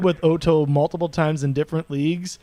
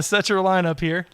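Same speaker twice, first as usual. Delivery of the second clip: ordinary delivery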